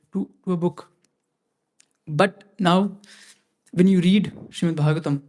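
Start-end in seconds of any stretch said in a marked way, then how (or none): none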